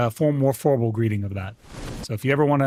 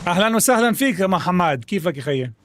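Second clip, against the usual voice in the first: Arabic greeting; 5 semitones higher, 4 dB quieter, much faster than usual. Retold or imitated. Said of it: "Muhammad was saying hello to me in Arabic".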